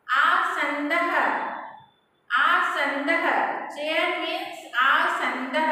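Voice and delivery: आसंदः drawn out slowly with a rising end; slow model word. Shown as a demonstration. Teacher drills आसंदः slowly for the class to echo. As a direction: stretch आसंदः so students can repeat it. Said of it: "you pronounce with me".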